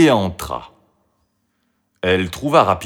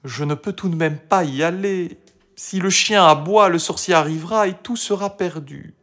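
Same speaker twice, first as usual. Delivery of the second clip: high-pitched disheartened voice; discouraged; 8 semitones higher than usual. A narrator's reading. Marie in her hiding place thinks all is lost, discouraged. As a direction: lose heart, voice raised in pitch. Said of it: "perdit courage".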